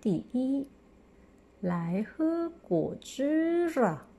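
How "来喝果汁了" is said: 来喝果汁了 slow and raised, 啊 sounding as 啦; 来喝果汁了 read aloud. Shown as a demonstration. Teacher models the 啦 change for the class, slowly. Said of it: "同学们来跟我一起读".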